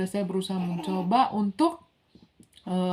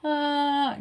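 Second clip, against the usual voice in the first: short high newborn cry, lightly mimicked; showing how her baby cried at birth; imitated newborn cry; 6 semitones higher than usual. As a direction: copy the baby's cry in a single burst. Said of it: "Tapi dia nangisnya".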